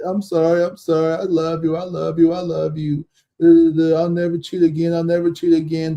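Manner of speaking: hammed-up tearful begging; mock boo-hooing. Cheating man on a dating show begging forgiveness, mock boo-hooing. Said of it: "this man was boo-hooing, crying in the face".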